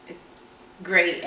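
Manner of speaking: normal talking voice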